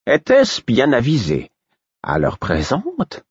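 playful fool asking a pointed question; the Fool's voice. Acted. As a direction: lift the voice and drawl the doubt.

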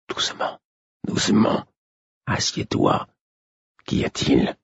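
hushed, urgent whisper. Acted. Old Imbre hushes Fitz, fearing listeners, in a whisper. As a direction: whisper as if someone might overhear. Imbre signals to speak low.

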